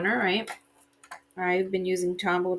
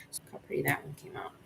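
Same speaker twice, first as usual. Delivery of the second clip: soft whispered aside; whispering; 4 semitones lower, 12 dB quieter, faster than usual. Real, not acted. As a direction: breathe it out under the breath. Whispered aside while crafting.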